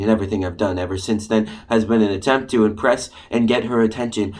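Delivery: usual speaking voice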